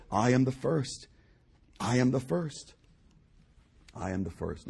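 low, soft preacher's declaration; hushed, weighty words of God; solemn hush. Acted. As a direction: drop right down after the shout.

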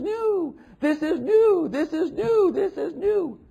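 high-pitched, gushing craze for novelty; over-the-top imitation of an excited fad chaser; mock giddy excitement. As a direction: squeal each "new" high, loud and drawn out. Mocking crowds craving anything new.

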